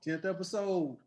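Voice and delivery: loud, upbeat sign-off; excited. Real, not acted. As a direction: pump up the closing thanks. Host hyping sign-off.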